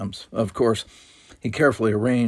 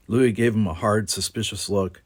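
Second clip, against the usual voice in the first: usual speaking voice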